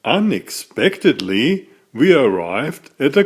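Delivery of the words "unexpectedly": ordinary delivery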